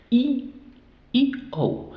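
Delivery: high-pitched "I" as ee, then "O" as ou; long vowel names. Shown as a demonstration. Teacher isolating open-syllable vowels, high long "ee" and "ou". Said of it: "deben decir su nombre".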